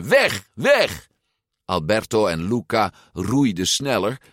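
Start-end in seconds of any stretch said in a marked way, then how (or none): 0.0-1.1 high, urgent warning shouts; shouting. A narrator's reading. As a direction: shout them away. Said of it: "schreeuwde ze". (dringend geschreeuwd)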